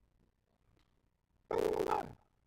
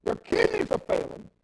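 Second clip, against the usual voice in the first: loud, rising sermon shout; preaching at full force; 7 semitones higher, 13 dB louder than usual. Acted. Preacher scolds a dying friend's vanity, loud full-force preaching. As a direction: hammer the point home from the pulpit.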